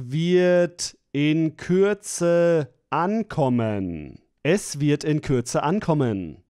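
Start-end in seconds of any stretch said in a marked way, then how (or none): none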